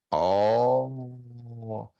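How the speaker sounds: long held, breath-started "oh"; rough aspiration sound. Shown as a demonstration. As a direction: demonstrate an aspirated sound, drawn out. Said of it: "áspera".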